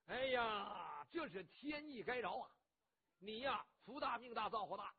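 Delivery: a boisterous hothead boasting; rough loud bandit. Acted. Cheng Yaojin tells Qin Qiong he saved him, brash and loud.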